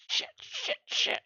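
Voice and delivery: hushed frantic cursing; whispered panic. Retold or imitated. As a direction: whisper the curses fast.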